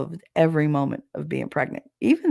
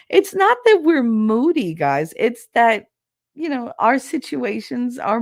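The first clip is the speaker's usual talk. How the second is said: high, loud defensive protest; 6 semitones higher, 4 dB louder than usual. Real, not acted. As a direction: insist you're not moody. Mock defensive to guys.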